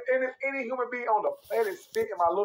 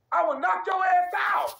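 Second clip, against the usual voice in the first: higher, slower, shouting his threat; heated shout; 9 semitones higher than usual. Real, not acted. Ranting to the stream about his brother, heated shout. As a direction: raise the voice and drag out the threat.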